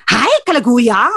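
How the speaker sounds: "Hi, kalaguya!" in a lightly blunt Kapampangan lilt; Kapampangan tone demo. Shown as a demonstration. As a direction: show the tone that sounds angry but is kind. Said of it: "Sa Kapampangan, ganito". (tonong Kapampangan na parang galit)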